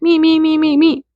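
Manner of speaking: mimicked high, monotone babble; wordless noise-making. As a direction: chirp it like a meaningless noise. Mimics what higher self understands.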